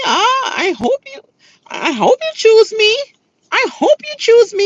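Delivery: mock pleading. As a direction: mimic a feeble plea, voice high. Mocks his weak pleading.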